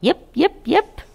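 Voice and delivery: high, sharp imitated puppy yip; a tiny dog yipping from the grass. Narrator voicing a hidden puppy, high yip.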